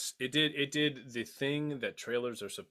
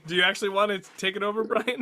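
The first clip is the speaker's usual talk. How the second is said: laughing; 8 semitones higher, 6 dB louder, faster than usual. Real, not acted. Laughing protest at co-host.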